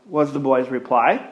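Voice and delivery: normal talking voice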